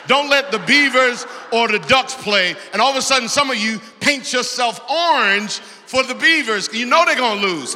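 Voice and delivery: loud, high shouted sermon; full-force preaching. Acted. Preacher shouting to the congregation about rival teams. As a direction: preach it out hard to the crowd.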